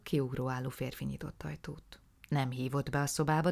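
usual speaking voice

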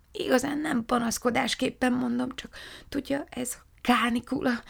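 high, delicate cloud voice, dropping to a whisper mid-phrase; a wilting cloud too polite to complain; polite weary cloud. Acted. Cloud apologetically explains the heat to the witches, high and fragile.

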